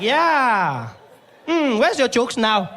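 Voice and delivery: high, loud, slow gloating; taunting receptionist. Retold or imitated. Receptionist gloats over comedian patient, high taunting voice. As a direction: gloat loudly with drawn-out words. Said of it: "She's like".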